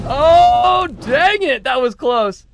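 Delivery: high, loud cry at a near miss; excited groan-shout. Real, not acted. Commentator reacting to a close rally, loud and high. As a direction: blurt it out as the point slips away.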